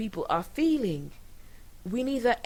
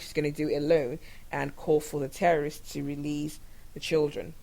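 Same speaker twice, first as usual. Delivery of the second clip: ordinary talk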